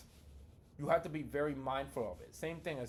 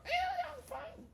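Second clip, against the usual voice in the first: very high, slow strained squeal; shrill squeak; slower than usual. Acted. Answering someone right after stubbing a toe, shrill squeak. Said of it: "You might get shrill. You might squeak up".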